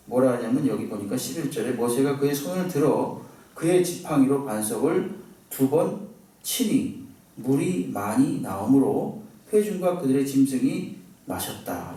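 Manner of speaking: normal talking voice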